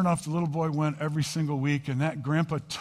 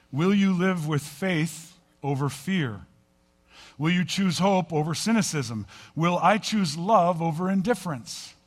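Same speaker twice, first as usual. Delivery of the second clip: preaching appeal; 4 semitones higher, 4 dB louder than usual. Acted. Preacher's closing rhetorical questions.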